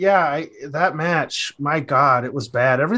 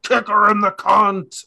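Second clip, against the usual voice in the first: higher, drawn-out mock yell; imitated wrestler's scream; 6 semitones higher, slower than usual. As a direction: yell it out like a crazed heckler. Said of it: "he was screaming things like".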